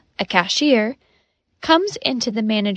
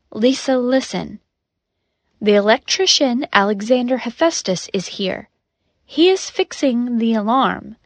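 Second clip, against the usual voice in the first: ordinary delivery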